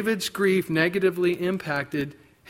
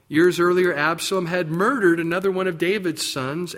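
normal talking voice

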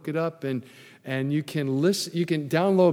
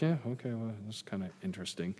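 bored men; 5 semitones lower, 12 dB quieter, faster than usual. Retold or imitated. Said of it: "Men are like".

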